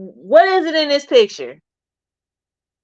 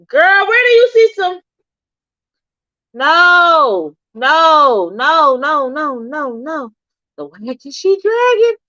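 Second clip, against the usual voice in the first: high, loud, excited exclaiming; loud excited reaction; 5 semitones higher, 7 dB louder, slower than usual. Real, not acted. Streamer exclaiming loudly at a photo for her viewers. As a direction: cry out at the picture, excited.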